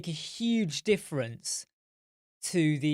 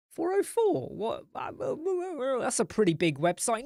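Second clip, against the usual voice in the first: a much higher, mock-astonished wife; wife hears about four oh four errors, mock amazement; wife amazed; 9 semitones higher than usual. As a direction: gasp it out like a shocked wife. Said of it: "she'll be like".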